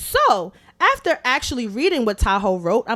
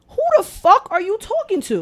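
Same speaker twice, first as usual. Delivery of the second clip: higher, louder indignant demand; indignant outburst; 10 semitones higher, 3 dB louder than usual. Retold or imitated. Venting at her friend over his rude text, higher and louder. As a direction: fire the question back at him. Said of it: "the little boy in me".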